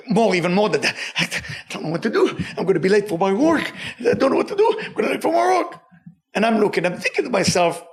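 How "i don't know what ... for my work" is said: starts mildly anxious, then jumps much higher into a whimpering plea; nervous panicking man. Retold or imitated. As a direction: play a sweating man late for work, panic building. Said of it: "he's very nervous, sweating".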